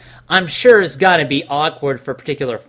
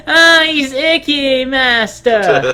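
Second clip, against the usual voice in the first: whiny servant voice; 6 semitones higher, 4 dB louder, much slower than usual. Pretend. Mock servant voice.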